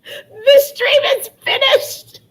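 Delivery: high, slow, tearful with laughter; real laughing tears, barely getting words out; weepy giggly wail; laugh-crying. Real, not acted. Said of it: "I'm crying".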